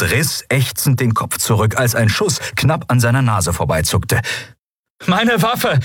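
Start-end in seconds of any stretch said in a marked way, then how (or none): 5.1-5.8 high, strained shout; urgent, high-pitched call. A narrator's reading. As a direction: yell it over gunfire. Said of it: "rief er".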